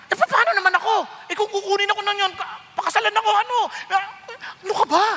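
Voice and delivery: high, loud, fast protesting wife; Sarah protesting. Acted. Sarah pleading with her husband Abraham, high protest. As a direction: whine it out like a worried wife. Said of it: "kunyari kung ikaw si Sarah".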